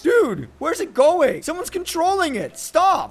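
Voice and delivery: high, loud alarmed cries as the yacht drifts off; real panic. Real, not acted. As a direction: yelp in alarm, pitch shooting up. Boat drifting away, panics.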